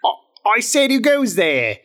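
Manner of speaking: high-pitched sentry repeating the challenge; calling out. Acted. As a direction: call it out to someone hard of hearing. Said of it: "calls out".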